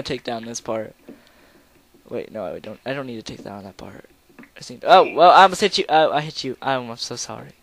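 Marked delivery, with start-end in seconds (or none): 4.9-7.5 loud apology. Real, not acted. Hit friend, apologizing loudly.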